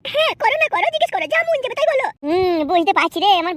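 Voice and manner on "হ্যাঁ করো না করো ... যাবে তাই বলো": put-on coy young bride. Bride asking the matchmaker where to sit, high coy voice. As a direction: play the shy village girl.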